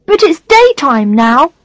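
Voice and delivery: put-on high, slow childish protest; little boy voice. A narrator's reading. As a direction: voice a small boy. Child character voice.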